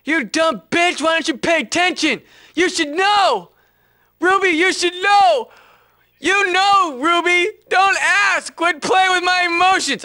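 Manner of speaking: high, loud, melodramatic yelling; over-the-top screechy tirade; hammed-up angry rant. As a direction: blow up at her in mock fury. Hammed-up angry rant at scripted character.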